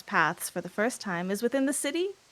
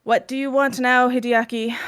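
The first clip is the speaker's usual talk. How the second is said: irritated father; 4 semitones higher, 7 dB louder than usual. Acted. Irritated father addresses son.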